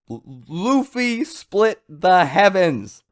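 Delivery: high, slow excited declaration; hyped again. Real, not acted. A fan thrilled at the big moment, high and slow. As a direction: savour it with fresh excitement. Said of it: "got super hyped again just saying it".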